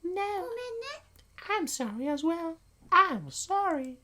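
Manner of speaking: very high squeaky apology; tiny high voice, drawing out the last sorry; apologetic girl character. Acted. Reader plays girl saying sorry back, very high and apologetic.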